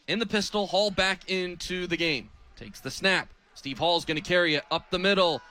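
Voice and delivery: ordinary delivery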